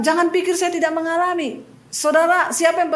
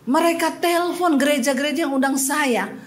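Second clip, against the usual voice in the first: ordinary delivery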